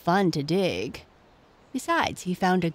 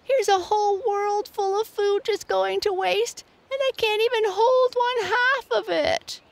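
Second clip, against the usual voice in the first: high whining piglet voice, a little louder; whiny piglet; 4 dB louder than usual. Acted. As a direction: whine it like a stuffed little pig. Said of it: "he whined".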